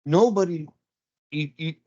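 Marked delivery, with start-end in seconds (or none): none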